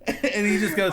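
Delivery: high voice breaking into laughter; cracking up mid-story; laughing. Real, not acted. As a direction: talk through helpless laughter.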